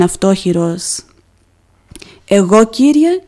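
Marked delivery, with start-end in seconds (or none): 2.2-3.2 a touch of tears in a strained voice; holding back tears as he answers; tearful. A narrator's reading. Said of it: "άρχισαν τα μάτια να στάζουν". (με λίγα δάκρυα στη φωνή)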